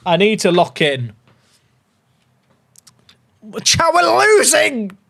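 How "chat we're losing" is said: high, drawn-out wail to the chat; alarmed cry. Real, not acted. Streamer tells chat the match is slipping, alarmed. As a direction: cry out in dismay, stretching it high.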